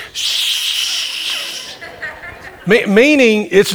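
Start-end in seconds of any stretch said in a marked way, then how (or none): 0.1-1.8 long, soft imitated shush. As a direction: hush them with a drawn-out "shh". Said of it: "And she goes".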